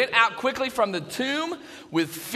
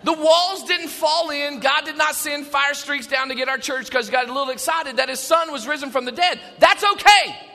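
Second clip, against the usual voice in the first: loud, higher, rejoicing; excited; 4 semitones higher, 5 dB louder than usual. Real, not acted. Pastor rejoicing before the congregation, loud and excited. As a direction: celebrate at full volume. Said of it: "get a little excited".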